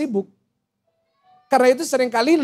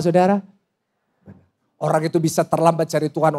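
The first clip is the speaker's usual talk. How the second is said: ordinary talk, nothing marked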